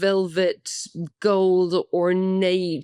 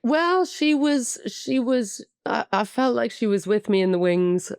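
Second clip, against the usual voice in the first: usual speaking voice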